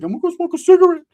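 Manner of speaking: high, loud cartoonish cousin voice; cousin Ricky voice. Cousin Ricky pulling him aside, in a high squeaky voice.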